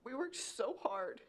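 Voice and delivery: fighting back tears as she speaks; getting emotional. Real, not acted. Mother pleading to city commissioners, choking up. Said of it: "Sorry, I'm getting emotional".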